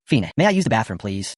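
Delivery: usual speaking voice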